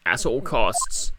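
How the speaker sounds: slow, heavy dramatic line. Acted. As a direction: draw it out with weight.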